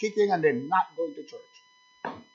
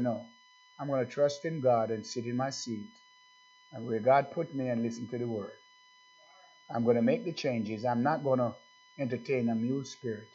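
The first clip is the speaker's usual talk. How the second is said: lowered, softer voice for the convert; a hint of a humble believer, softer; quoted quiet vow; 4 semitones lower, 6 dB quieter than usual. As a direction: murmur the convert's vow to himself.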